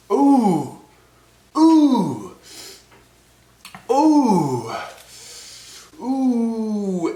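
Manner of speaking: loud, high falling hoots of pain; pained high "ooh". Real, not acted. Chip eater hit by the heat, high pained hooting. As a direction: whoop out the burn as it hits. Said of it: "It is hard to breathe".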